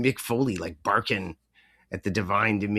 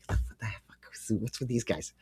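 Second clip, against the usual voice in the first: soft disbelieving whisper, much quieter; whispered aside; 7 dB quieter than usual. Retold or imitated. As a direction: mutter it under your breath in disbelief. His private reaction to the guys.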